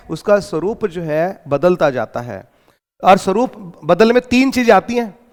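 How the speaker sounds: ordinary talk, nothing marked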